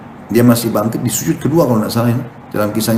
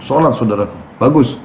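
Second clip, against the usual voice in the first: normal talking voice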